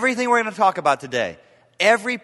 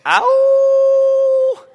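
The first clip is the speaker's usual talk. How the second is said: mock ow; 10 dB louder than usual. Reacts to own hard point.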